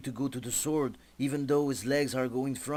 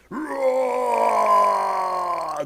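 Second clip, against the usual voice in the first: loud, higher, angry bellow; monster roar; 9 semitones higher, 8 dB louder than usual. Acted. The enemy roars in rage at the heroes. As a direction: roar like an enraged giant.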